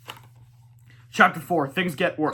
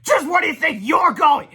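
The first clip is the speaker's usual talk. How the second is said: high, loud bossy shout; high shouted demand; 12 semitones higher, 9 dB louder than usual. Acted. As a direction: stop her in her tracks. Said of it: "shouted Melvin".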